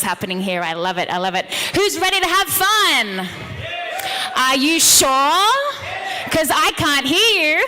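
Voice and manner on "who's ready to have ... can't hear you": loud, high call to the crowd. Real, not acted. Speaker rousing the audience to cheer back, loud and high. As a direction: pump up the room with a big shout-out. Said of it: "I'm very loud on this microphone".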